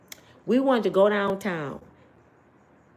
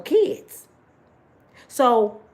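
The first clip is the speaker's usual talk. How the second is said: normal talking voice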